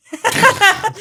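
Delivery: high, loud laughing burst; burst of laughter. Real, not acted. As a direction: crack up at your own joke. Laughs at her own joke.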